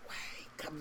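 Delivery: breathy whisper, no voice. Pretend. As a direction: whisper it like a hidden warning. Whispered hornet message.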